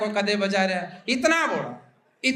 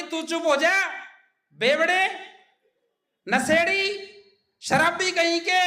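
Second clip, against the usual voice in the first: wife scolding back; 7 semitones higher than usual. Retold or imitated. Wife yelling back at drunk.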